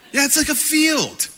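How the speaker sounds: hammed-up exasperation; mock exasperated. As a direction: throw up your hands in mock disbelief. Mock incredulous retort.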